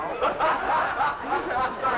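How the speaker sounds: laughing. Real, not acted. Guessing game, he fumbles words.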